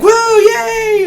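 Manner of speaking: put-on very high, loud kid's cheer; little boy playing with toys, cheering high and loud; excited cheer. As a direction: cheer with childish glee.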